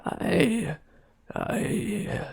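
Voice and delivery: frightened blurt. A narrator's reading. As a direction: falter then blurt it out. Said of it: "he blurted out".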